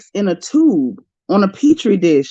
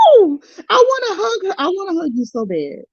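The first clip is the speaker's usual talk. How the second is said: emotional cry; 10 semitones higher than usual. Real, not acted.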